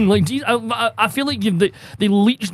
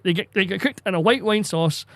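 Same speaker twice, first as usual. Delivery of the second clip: ordinary talk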